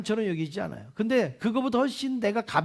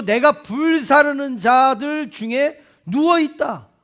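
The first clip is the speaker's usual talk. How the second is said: slow emphatic proclamation; 5 semitones higher, 5 dB louder, slower than usual. Acted. Preacher proclaims the verse.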